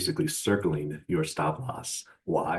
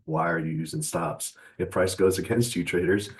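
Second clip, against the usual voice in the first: normal talking voice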